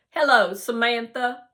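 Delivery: loud, smooth movie-star hello; cool greeting. Acted. Harlan greeting girl coolly.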